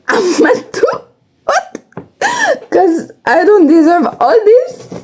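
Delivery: high-pitched, half-laughing comeback; indignant protest. Acted. Daughter telling mom she is mad too, high indignant protest. Acts out answering back to mom.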